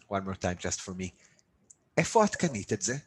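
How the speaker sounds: ordinary delivery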